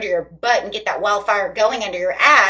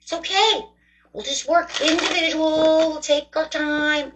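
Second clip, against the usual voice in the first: high, slow, drowsy unbothered voice; a lazy Taurus self saying take it easy; 7 semitones higher, slower than usual. Pretend. Her Taurus side dragging its feet, high and slow. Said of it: "my Taurus is like".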